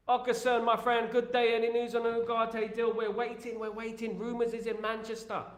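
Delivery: high, bright-pitched chat read-out; superchat read. Acted.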